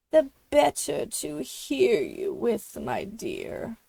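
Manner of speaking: a hint of a scratchy false granny; wolf as Granny. A narrator's reading. Wolf answers Little Red, lightly faking Granny. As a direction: fake granny sweetness with a wolf edge.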